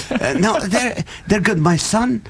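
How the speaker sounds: usual speaking voice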